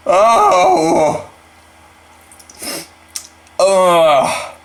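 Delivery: high, loud cry of disgust; shocked disgust. Real, not acted. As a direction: recoil from the taste. Reacts to the swallowed bug.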